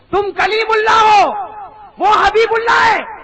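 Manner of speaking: loud, high and slow proclaiming; shouted sermon line. Acted. Preacher repeating God's words to Moses, shouted slowly. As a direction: ring it out over the crowd.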